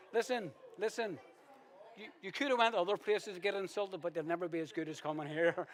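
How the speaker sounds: loud, high, teasing boast; playful shout. Real, not acted. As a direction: belt the joke out to the room.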